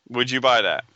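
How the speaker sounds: normal talking voice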